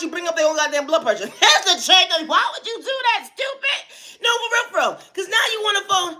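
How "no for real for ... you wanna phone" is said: ordinary talk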